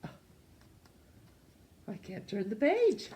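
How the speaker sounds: plain reading voice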